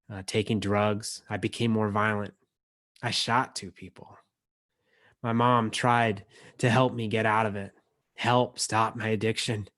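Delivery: ordinary delivery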